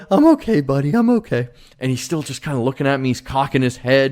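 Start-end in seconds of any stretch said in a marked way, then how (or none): 0.1-1.5 much higher, tender reassurance to the dog; tearful reassurance. Retold or imitated. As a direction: soothe the worried dog while fighting tears. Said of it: "and I'm Disney crying. I'm like".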